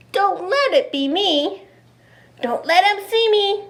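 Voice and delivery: whimpering plea. Acted. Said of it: "whimpered Eddie".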